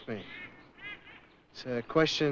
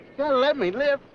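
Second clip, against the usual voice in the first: higher, louder, begging for his life; desperate plea; 8 semitones higher, 4 dB louder than usual. Acted. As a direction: plead with the general not to kill you.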